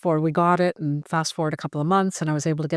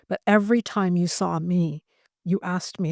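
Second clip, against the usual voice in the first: ordinary talk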